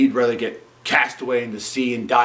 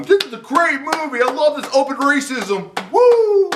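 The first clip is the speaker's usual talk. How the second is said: much higher, mock-gushing fan voice, ending in a loud woo; sarcastic falsetto cheer, building to a loud whoop; 5 dB louder than usual. Mocking imagined fans of the movie.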